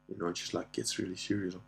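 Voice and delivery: ordinary delivery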